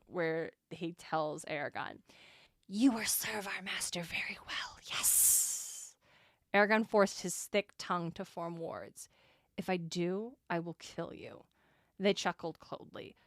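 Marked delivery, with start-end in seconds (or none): 2.7-6.0 whispered villain threat; hissing whisper. Acted. Reading villain's whispered line. 9.6-11.4 low quiet threat. Acted. Reading hero's threat.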